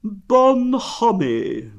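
gloomy, very drawn-out high word; Eeyore. A narrator's reading. Donkey going on about gaiety, gloomy. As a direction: stretch it out loud and dolefully. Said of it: "went on Eeyore gloomily".

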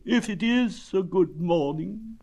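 high, slow gloom; doubtful, drawn-out donkey moan; Eeyore. A narrator's reading. Donkey doubting it is a good morning, gloomy. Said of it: "said Eeyore gloomily".

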